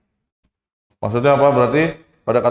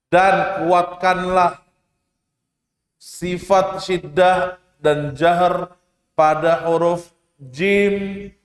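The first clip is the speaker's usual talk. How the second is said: slow reading; 3 semitones higher, slower than usual. Shown as a demonstration. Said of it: "teacher reading the rule".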